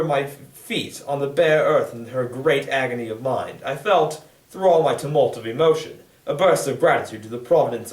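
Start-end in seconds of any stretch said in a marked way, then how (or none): none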